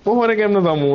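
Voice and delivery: frustrated gamer reply; exasperated. Real, not acted. Exasperated reply during firefight.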